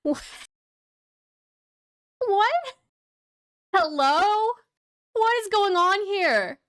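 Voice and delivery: high-pitched, incredulous questioning; baffled disbelief. Real, not acted. Reactor baffled by clinking glasses, high incredulous questions. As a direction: call out in bewilderment, pitch leaping up.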